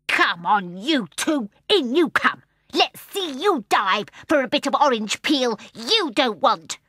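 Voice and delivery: loud, rising taunt from the pool; the seal taunts Jane and Michael bitterly; bitter, angry voice. A narrator's reading. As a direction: jeer at them with spite. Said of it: "bitter, angry voice".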